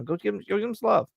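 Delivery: normal talking voice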